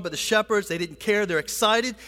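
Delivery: ordinary talk, nothing marked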